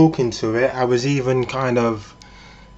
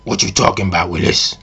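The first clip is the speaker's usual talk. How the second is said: deep put-on sitcom catchphrase; low mock impression of a TV catchphrase; Arnie from Different Strokes; 5 semitones lower than usual. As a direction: drop right down into a man's character voice. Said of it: "kind of like Arnie from Different Strokes".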